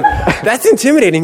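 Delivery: very high comic alarm; mock intimidated. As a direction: protest in exaggerated high-pitched fright.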